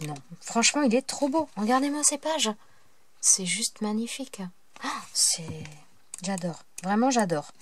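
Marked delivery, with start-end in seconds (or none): 3.2-6.6 chuchotement ravi. Real, not acted. Chuchote devant les pages.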